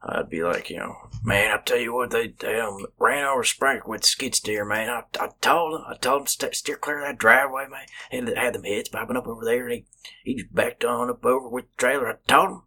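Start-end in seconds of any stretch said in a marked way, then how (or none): 1.2-7.8 higher-pitched country jobsite talk; country work accent. Acted. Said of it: "way more country". 8.1-12.6 higher, garbled country work talk; country gibberish. Acted. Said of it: "You're speaking fucking gibberish".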